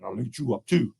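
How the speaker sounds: low, soft menace; bear's quiet threat. Acted. Bear's final threat, dropped low.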